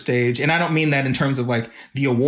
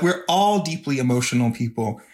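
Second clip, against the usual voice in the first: normal talking voice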